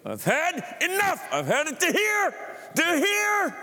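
the way you really say it when pushed too far; high, drawn-out, fed-up exasperated cry; exasperated outburst. Shown as a demonstration. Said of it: "That's not what you do... You go".

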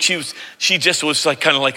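ordinary talk, nothing marked

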